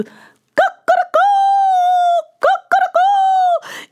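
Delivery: high crowing cock-a-doodle; rooster crow. Acted. Hen greets the dawn with a high crow.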